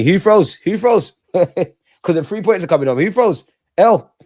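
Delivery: loud, high taunt with a laugh; gloating shout. Real, not acted. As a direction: rub it in, loud and cocky. Gloating at a chatter.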